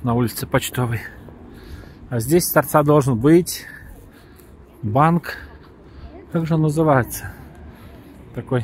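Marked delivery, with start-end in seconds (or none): none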